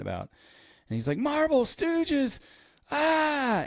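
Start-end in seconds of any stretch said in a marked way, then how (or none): 1.2-2.4 high, slow, drawn-out yell; mock scream. Husband explaining to baffled wife by screaming, mock scream. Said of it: "he is screaming in bed". 2.9-3.6 high-pitched retold scream; mock scream. Man screaming beside his baffled wife, mock scream. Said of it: "he is screaming in bed".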